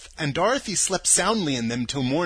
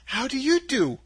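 put-on higher-pitched, polite girl; 8 semitones higher than usual. A narrator's reading. Dorothy returning the scarecrow's greeting, polite. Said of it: "replied Dorothy politely".

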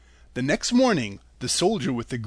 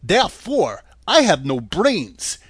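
louder, higher earnest straw man; brave scarecrow; 3 semitones higher, 8 dB louder than usual. A narrator's reading. Scarecrow bravely asking the lady for brains, louder and higher. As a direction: answer up bravely and loud. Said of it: "he answered her bravely".

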